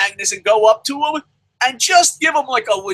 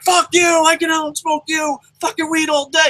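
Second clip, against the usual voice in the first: mock angry rant; 7 semitones higher than usual. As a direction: play the hothead blowing up at a fan. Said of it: "he'll be like".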